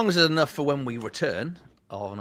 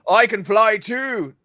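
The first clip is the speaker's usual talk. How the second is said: slow, slightly higher boast; mock proud flyer; 7 semitones higher, 5 dB louder, slower than usual. Player boasting to the Dibbons before falling, slow mock pride. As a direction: announce your flight like a show-off. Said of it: "Get on the table".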